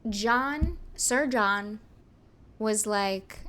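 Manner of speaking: ordinary talk, nothing marked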